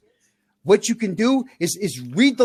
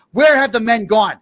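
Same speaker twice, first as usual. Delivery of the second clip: ordinary delivery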